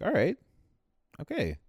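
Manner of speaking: acted awkward keep-your-distance reply; high, curt, mock-wary acknowledgement; standoffish. Acts standoffish reply to strangers.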